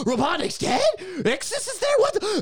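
high, rising, laughing disbelief; retold fan shock; shocked fan reaction. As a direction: gasp it out like a stunned kid at the finale. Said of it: "And it's like, gasps".

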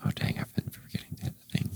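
fast whispered aside. Real, not acted. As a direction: whisper it quickly to yourself.

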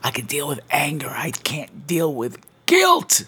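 hushed, slow anguished whisper; guilty whisper. Retold or imitated. Child whispering to mom, crushed by guilt. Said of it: "And you're like".

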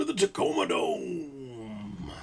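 booming arena-ad hype; monster truck announcer. Acted. Host parodying monster truck ads, hammed-up announcer voice. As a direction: stretch it out like a truck rally ad. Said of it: "monster truck announcers".

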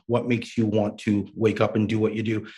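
ordinary delivery

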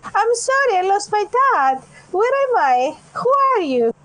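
put-on lost little girl. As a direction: play a scared kid who lost her dad. Player voices Opal as lost child.

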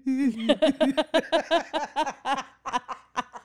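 crying-sounding laugh. Real, not acted. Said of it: "I just sound like I'm crying".

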